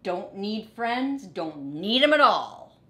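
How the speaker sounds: defiant lonely rat; sulky grumble. Acted. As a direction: mutter it stubbornly. Rat's sulky refrain.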